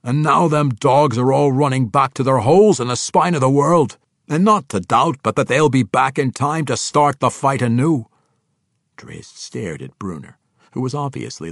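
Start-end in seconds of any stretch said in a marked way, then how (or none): 0.0-8.1 loud, raised voice with a thick dwarvish brogue; indignant dwarf king. Acted. Dwarf king complaining to his drow friend, loud and indignant. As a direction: rail loudly about the enemy as a dwarf.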